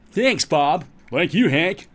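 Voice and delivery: put-on loud, high American TV-host accent; peppy mock American accent. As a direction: trade thanks like hammed-up American presenters. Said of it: "speaking with an American accent".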